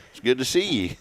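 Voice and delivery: breezy fake Texas drawl, hamming it up; mock Texas drawl. Shown as a demonstration. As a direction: slide into a weird Austin cowboy greeting. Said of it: "I'm just gonna start talking like".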